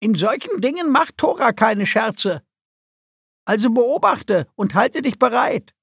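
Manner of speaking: high-pitched, insistent little creature; admonishing creature. A narrator's reading. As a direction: lecture her firmly in a high creature voice. Guki admonishes Celestaris.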